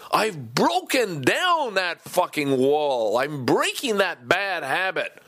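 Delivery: real excited screaming rant in the basement; excited yelling. Real, not acted. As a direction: shout it out, thrilled and liberated. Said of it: "me screaming and yelling at you".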